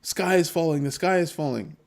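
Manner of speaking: high, whiny mock wail, overplayed; mock the panicking crowd like a crying child; mock panic. Vlogger mocking panicking traders with a high fake wail. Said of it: "they're freaking out".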